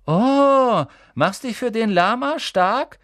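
high, loud mocking schoolgirl; sneering Pansy. Pansy mocking Parvati, sneering and high. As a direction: jeer at her like a spiteful girl.

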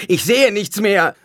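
slow, high, panicked scream. A narrator's reading. A victim discovers they have gone blind, screaming. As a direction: wail it out at full voice. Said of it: "schrie jemand".

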